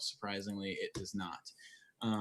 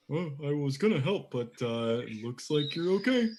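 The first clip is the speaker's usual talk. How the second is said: higher, put-on character voice with a rising end; Adon impersonation; 4 semitones higher than usual. Adon walks over to Dahl offering help, higher character voice. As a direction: put on a higher voice for an easygoing helper. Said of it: "That was a very good impersonation".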